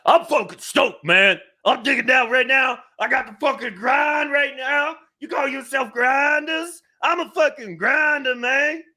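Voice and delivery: shouted, very high caricature voice; hammed-up caricature accent. Co-host hyping himself as a grinder, hammed-up high caricature. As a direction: yell it high as an over-the-top caricature. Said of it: "that was very racist".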